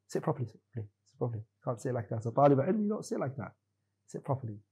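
hushed, firm aside to a student; quiet correction. Real, not acted. Teacher quietly telling a slouching student to sit up, hushed and firm. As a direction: soften right down and tell him off under your breath.

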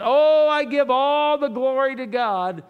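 loud, high, over-the-top praise; mock exuberant praise. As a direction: gush it out like showy, hammed-up worship. Mimicking people praising when things go well.

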